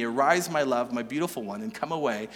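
soft and low, sinking away; tender. Retold or imitated. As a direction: say it gently to the beloved. Tender quote read again.

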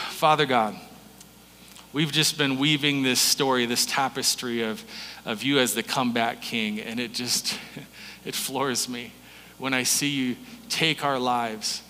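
soft, low prayerful voice. Acted. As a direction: pray quietly and intimately. Pastor prays over congregation.

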